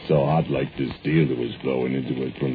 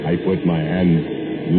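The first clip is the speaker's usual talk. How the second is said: ordinary delivery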